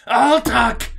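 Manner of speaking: high, loud cry of surrender; panicked shout. Acted. Cornered alien surrendering to escape the food, shouted. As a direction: shout it out, giving in. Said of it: "he shouted".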